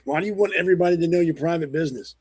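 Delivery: normal talking voice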